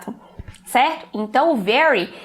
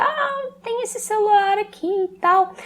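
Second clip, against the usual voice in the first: seller's voice; 9 semitones higher than usual. Retold or imitated. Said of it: "o vendedor vai e me mostra".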